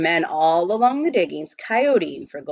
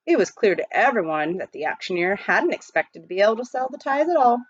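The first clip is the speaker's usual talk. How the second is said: ordinary delivery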